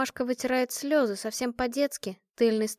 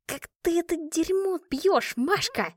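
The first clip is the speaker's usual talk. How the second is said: slow, strained, higher voice; choking after a gulp; 8 semitones higher, slower than usual. A narrator's reading. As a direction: force the words through a burning throat. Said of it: "закашлявшись". (сдавленно, поперхнувшись)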